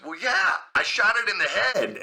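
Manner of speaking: high, as if it's obvious; high-pitched mock defensiveness; matter-of-course. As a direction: imitate a caller stating the obvious. Retold caller confirms, as if obvious.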